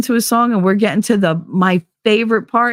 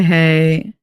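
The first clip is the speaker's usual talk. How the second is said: ordinary talk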